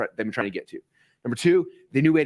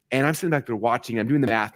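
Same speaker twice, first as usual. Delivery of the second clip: normal talking voice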